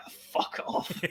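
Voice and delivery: breathy whispered fuck off; muttered dismissal. Retold or imitated. Viewer reacting to the film, whispered scoff. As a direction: hiss it under your breath. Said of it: "I was like".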